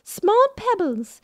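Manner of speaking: lilting museum curator owl; Blathers voice. Acted. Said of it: "you really take it to the character".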